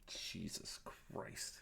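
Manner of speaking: slow breathy Jesus Christ under the breath; exasperated whisper. Real, not acted. Fed up with the scene, whispers to himself slowly. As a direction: mutter it to yourself, fed up. Exasperated aside while scrubbing video.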